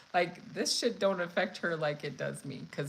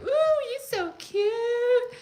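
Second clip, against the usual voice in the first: flirty mom voice; 14 semitones higher, 7 dB louder than usual. Retold or imitated. Stoned mom flirting with shoppers, high sing-song coo. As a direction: coo at strangers like a tipsy mom. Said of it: "Yelling at everybody in the dollar store".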